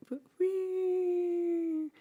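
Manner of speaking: high, loud whistling whee; whooshing whee. Retold or imitated. Narrating the rocket, a high loud whee. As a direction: squeal it high like a rocket passing. Spaceship flies overhead.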